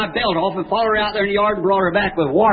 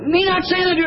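much higher imitated wifely objection; indignant retort; 11 semitones higher than usual. Wife interrupting husband in a quarrel, mock indignant. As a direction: cut in high, as if offended.

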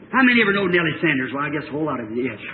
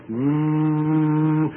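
long, level "woo"; imitated bat noise; 3 semitones lower than usual. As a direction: drone like a creature flying up. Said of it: "It's going".

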